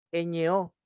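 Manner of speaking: "Ñ O" spelled out letter by letter, clearly. Shown as a demonstration. Teacher spelling ño for the class. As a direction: spell out ñ and o separately. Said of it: "dicen ño".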